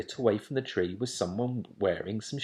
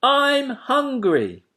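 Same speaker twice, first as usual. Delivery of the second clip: put-on high, slow child voice; a small owl child whining; little owlet; 8 dB louder, much slower than usual. A narrator's reading.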